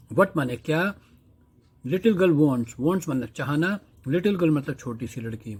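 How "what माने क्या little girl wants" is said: usual speaking voice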